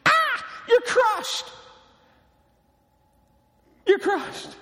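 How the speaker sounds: high, slow anguished cry; crushed cry. Acted. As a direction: wail it out like a crushed man. Preacher cries out over lawyer.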